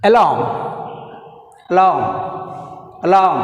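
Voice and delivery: "alarm" as a-LAAM, silent r, LARM punched; stress on second syllable. Shown as a demonstration. Teacher drilling stress for students, strong LARM in alarm. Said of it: "second syllable ఎల్".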